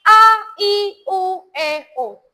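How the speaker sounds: "A, I, U, E, O" each vowel sounded out separately and clearly; clear vowel articulation. Shown as a demonstration. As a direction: model the vowels "A, I, U, E, O" one by one with wide mouth shapes. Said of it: "kita harus lafal artikulasi". (ucapkan "A, I, U, E, O" dengan artikulasi jelas)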